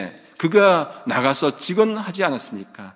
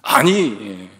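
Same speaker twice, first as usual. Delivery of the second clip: imitated startled outburst; 5 semitones lower, much slower than usual. Dozing elder jolted awake in Bible study, startled imitation. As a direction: blurt it like a dozer woken suddenly. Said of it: "활짝 계셔갖고는 자면서".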